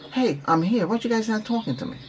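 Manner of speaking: high-pitched, put-on protest; mock plea for attention. Coach acting out an ignored guest calling to the group, mock indignant.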